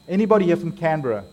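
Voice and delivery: ordinary delivery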